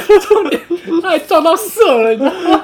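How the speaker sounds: high, loud telling through laughter; can barely get the words out for laughing. Real, not acted. Teller reaching the punchline for his friend, laughing hard.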